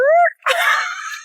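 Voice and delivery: high squealing burst of laughter; shrieking laugh. Real, not acted. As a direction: let out a shocked, high laugh. Shocked by Gary Vee's words.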